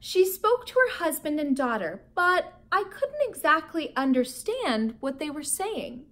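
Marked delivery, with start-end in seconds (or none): none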